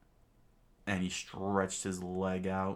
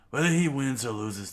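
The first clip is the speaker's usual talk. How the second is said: louder, firm statement; loud declaration; 6 semitones higher, 10 dB louder than usual. Acted. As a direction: proclaim it. Sanji declares.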